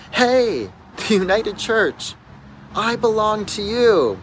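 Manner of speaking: high, loud call across a crowd; woman yelling out. Retold or imitated. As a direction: holler it joyfully from the roadside. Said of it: "one woman yell out".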